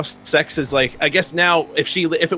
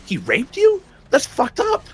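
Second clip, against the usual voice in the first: shocked disbelief; 10 semitones higher than usual. Retold or imitated. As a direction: pitch jumping high in shock. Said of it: "I was like".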